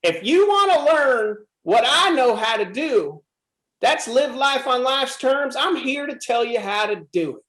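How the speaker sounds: put-on gentle old Southern Texas accent, giving advice. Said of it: "in his little Southern voice and his little Southern Texas accent".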